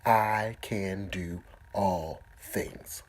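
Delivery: very slow, drawn-out words; slow affirmation. Shown as a demonstration. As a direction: stretch each word out. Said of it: "I want you to begin to say this".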